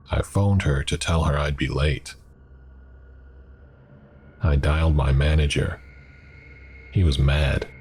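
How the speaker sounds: usual speaking voice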